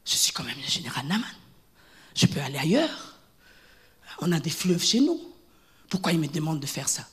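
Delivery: the general offended in his honour; proud general. Retold or imitated. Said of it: "Il regarde à son honneur". (le général Naaman vexé, voix basse)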